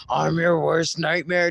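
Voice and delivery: a hint of a spooky villain; mock villain. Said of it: "Silly said".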